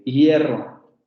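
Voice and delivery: ordinary delivery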